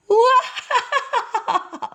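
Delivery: high, ringing laugh; the father's delighted laugh; hearty laugh. Acted. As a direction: let the laugh burst out. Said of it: "laughed Father Ox".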